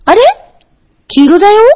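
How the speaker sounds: a bear cub suddenly startled by the yellow light; surprised. A narrator's reading. Bear cub gasps in surprise at the traffic light, startled. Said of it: "熊の子はびっくりして言いました".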